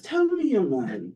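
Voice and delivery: high-pitched imitation of an Oxford don; British Oxford accent. Emeritus professor addressing a student in the dining hall, mock Oxford accent. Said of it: "this is my best British Oxford accent".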